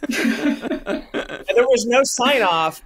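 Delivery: laughing it out slowly; drawn out through real laughter. Real, not acted. Recalling the unsigned email, laughing slowly.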